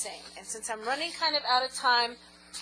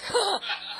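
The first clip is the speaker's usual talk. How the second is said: high breathless laugh; laughing fit; 7 semitones higher than usual. Real, not acted. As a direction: laugh until it squeaks.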